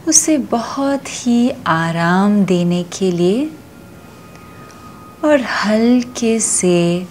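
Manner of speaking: slow, soothing yoga nidra voice with long pauses; guided meditation. Acted. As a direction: stretch the key words and let each phrase settle. Leads yoga nidra relaxation.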